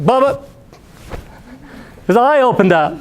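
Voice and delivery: high-pitched mock panic; alarmed cry. Hunter warns boat mate of gator's open eye, acted high alarm. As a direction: call out in exaggerated alarm.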